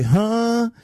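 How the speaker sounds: put-on high childish huh. Child answering house mother.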